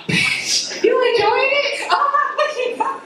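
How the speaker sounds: high-pitched squeal through laughter; incredulous high laughing outburst; shocked disbelief. Retold or imitated. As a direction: squeal it, scandalized and cracking up. Shocked reaction to his confession.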